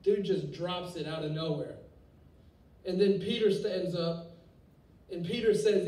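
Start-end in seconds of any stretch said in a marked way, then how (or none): none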